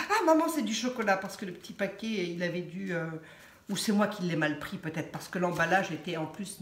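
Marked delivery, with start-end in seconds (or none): 0.1-1.2 high, loud, quick mock exclamation; imitating excited daughter. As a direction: imitate a daughter squealing to her mum. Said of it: "ma fille me dit". (imitation d'une fille tout excitée)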